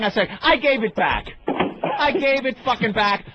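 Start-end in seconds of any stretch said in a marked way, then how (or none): 0.4-1.3 raised, emphatic comeback; retold indignant retort; defiant retort. Acted defiant reply to dealer. 2.0-3.2 higher-pitched, exasperated repeat; retold, escalating indignation; angry retort. Escalated repeat of defiant reply.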